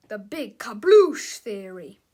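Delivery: booming title. A narrator's reading. Narrator announces the chapter title, booming. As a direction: announce the title loud, punching kabloosh.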